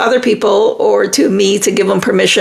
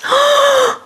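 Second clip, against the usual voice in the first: high shocked gasp. Real, not acted. Shocked by a viewer's news.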